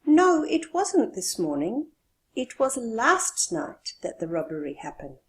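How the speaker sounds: correcting someone, stressing last night; no, it wasn't morning, stress it; 1 emphatic correction. Shown as a demonstration. The speaker corrects someone who thought it was morning, emphatic. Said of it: "So you have to emphasize this fact".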